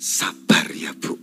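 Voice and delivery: very low, slow, hushed comforting; acted intimate pastoral consoling; gentle, soft-spoken. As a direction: soothe her slowly, almost under the breath. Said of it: "Pasti halus ngomongnya".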